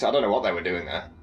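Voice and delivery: normal talking voice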